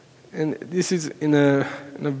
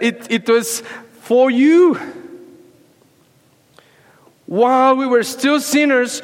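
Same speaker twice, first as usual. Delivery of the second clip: preaching at full force; 9 semitones higher than usual. Acted. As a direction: hammer "for you" home, pleading.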